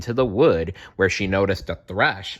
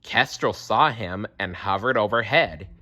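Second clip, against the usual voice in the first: usual speaking voice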